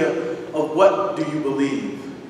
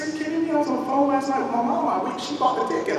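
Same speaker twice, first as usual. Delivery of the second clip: much higher, disbelieving outburst; incredulous; 10 semitones higher, 3 dB quieter, faster than usual. Retold or imitated. Traveler stunned at the counter, incredulous and high. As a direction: hit the pitch up in shock.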